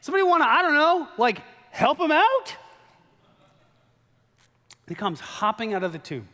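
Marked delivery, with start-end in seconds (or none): 0.0-2.6 high, loud, casual ask; casual offhand Jesus. Acted. Jesus casually asking the crowd to unwrap Lazarus, high and loud. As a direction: toss it off like it's no big deal. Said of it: "And Jesus goes".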